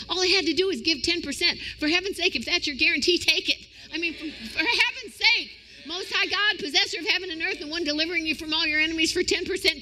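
high, loud, fast preaching climb; rising sermon fervor, pushing them to take the deal; preaching at full force. Acted.

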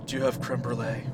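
breathless pothead voice; wheezing, short of air; out of breath. Acted. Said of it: "Why am I talking like I'm out of breath?".

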